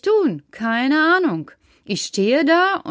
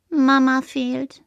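leise, langsam, traurig; 4 semitones higher, slower than usual. Acted. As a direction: sag es niedergeschlagen und langsam. Trauriger Karl. (Karl gesteht traurig und langsam, dass Mama fehlt)

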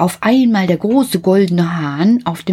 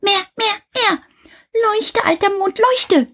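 put-on high, excited little-boy voice; a greedy child crying out for more; Häwelmann shouting. A narrator's reading. Little Häwelmann cries out for more, high and eager. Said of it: "schrie Häwelmann".

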